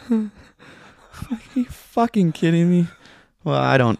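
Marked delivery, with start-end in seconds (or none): 0.1-2.9 higher, acted incredulous outburst; mock disbelief. Patient reacts to the ambulance breaking down, in acted disbelief. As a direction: play exasperated disbelief, pitched up.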